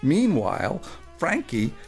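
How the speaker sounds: ordinary talk, nothing marked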